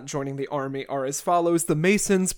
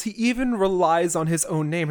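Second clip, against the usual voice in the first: normal talking voice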